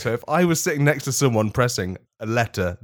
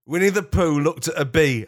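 normal talking voice

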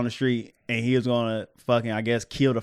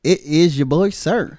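hype intro; 4 semitones higher, 7 dB louder, slower than usual. Acted.

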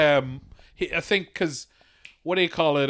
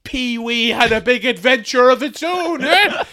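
high comic voice climbing higher; put-on high voice with laughter; cartoonish joke voice; 6 semitones higher than usual. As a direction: push the joke up into a silly squeal. High caricature in comic riff.

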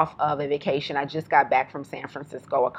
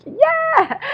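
high, bright excited squeal; excited yeah; 5 dB louder than usual. Retold or imitated. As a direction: gush it like an exclamation point. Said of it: "replied to both of her emails with a whole bunch of exclamation points".

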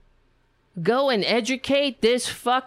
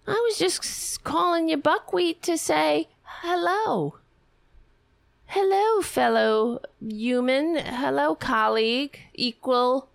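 higher, sing-song fake innocence; a higher, mock-sweet congressman pleading victimhood; mock whiny victim; 4 semitones higher than usual. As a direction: play the wounded innocent.

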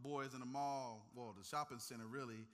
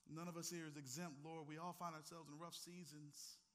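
soft prayer; 5 dB quieter than usual. Acted. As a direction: pray gently after the storm. Closing prayer, soft and low.

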